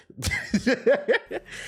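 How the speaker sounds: high-pitched laugh; amused high cackle. Real, not acted. Laughs after tech complaint.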